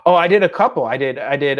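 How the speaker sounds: usual speaking voice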